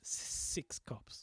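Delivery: slow, halting whisper; whispering on the phone. Retold or imitated. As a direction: whisper it fearfully. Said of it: "she really does have to whisper".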